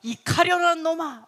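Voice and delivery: loud, high mock telling-off; scolding. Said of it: "헤르몬은 맨날 혼나요".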